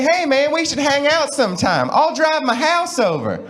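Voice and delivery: high, mock Southern twang; Southern good old boy accent, stretched at the end. Southerner inviting a friend to hang out, high Southern accent. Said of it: "Southern accent's a little bit more like".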